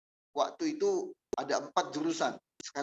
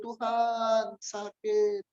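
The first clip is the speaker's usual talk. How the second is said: higher, louder plaintive whimper to God; gentle complaint; 7 semitones higher, 6 dB louder, much slower than usual. Retold or imitated. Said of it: "aku hanya ngeluh".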